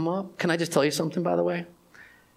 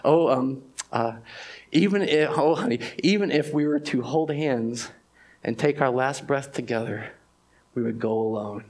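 tender whisper of comfort; 4 semitones lower than usual. Retold or imitated. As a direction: hold his hand with your voice. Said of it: "she says".

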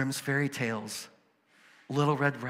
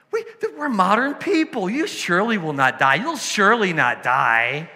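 hammed-up mocking voice, higher and louder; mocking modern people; 6 semitones higher, 10 dB louder than usual. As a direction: poke fun at squeamish modern retellers. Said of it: "And they said".

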